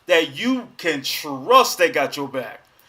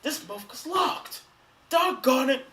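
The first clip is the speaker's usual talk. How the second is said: high, hushed frustrated grumble; thwarted intruder; 5 semitones higher, 6 dB quieter than usual. Acted. Intruder jiggling a locked doorknob, hushed mock frustration. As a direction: grumble under your breath, pitched up, as a foiled burglar. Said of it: "jiggling the doorknob".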